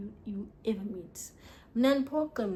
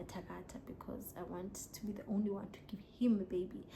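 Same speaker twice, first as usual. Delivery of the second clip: whisper; 8 dB quieter than usual. Real, not acted. Confiding what she was accused of.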